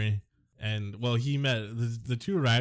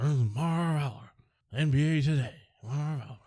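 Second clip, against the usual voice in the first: higher sportscaster sign-on; Marv Albert impression; 5 semitones higher than usual. Shown as a demonstration. Host repeats his Marv Albert sign-on, announcer style. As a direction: introduce the show like the veteran announcer. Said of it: "your impersonation is good".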